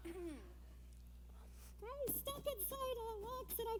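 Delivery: high, muffled closed-mouth voice; mouth-closed talking. Shown as a demonstration. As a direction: speak without opening your lips. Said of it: "I can talk with my mouth closed".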